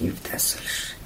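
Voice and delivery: very soft, whispery aside; embarrassed correction. A narrator's reading. Husband cutting in to correct his wife, embarrassed and hushed. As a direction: slip it in sheepishly under the breath. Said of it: "با خجالت گفت".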